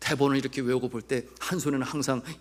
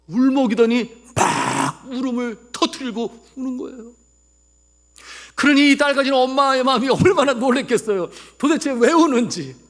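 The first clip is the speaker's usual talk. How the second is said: high, loud dramatic pulpit storytelling; full-force preaching; 12 semitones higher, 12 dB louder than usual. Real, not acted. Preacher telling of daughter's sudden tears, full-force preaching. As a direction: cry out the mother's alarm to the congregation.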